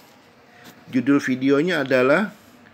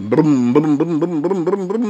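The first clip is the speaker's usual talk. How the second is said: revving "brem" noises, rising higher and louder; imitated car engine; 5 semitones higher, 4 dB louder than usual. Mechanic describing the sound of an electric car, imitated engine revs. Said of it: "cuma sekedar bunyi kayak mobil".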